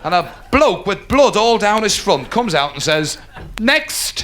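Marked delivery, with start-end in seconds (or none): 3.6-4.2 high, drawn-out "next"; high-pitched summons to the waiting room; dentist's call. Acted. Dentist calls next patient.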